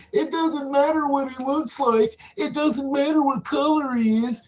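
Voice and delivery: high-pitched mock quote; mimicking everybody. Mimics what everybody says.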